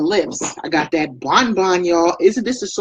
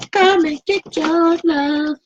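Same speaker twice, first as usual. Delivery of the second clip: high, slow sing-song invitation; playful pitch; 12 semitones higher, much slower than usual. Pretend. Vlogger invites local viewers to buy, playful sing-song. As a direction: coax them in like a flirty salesgirl.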